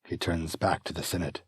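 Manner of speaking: normal talking voice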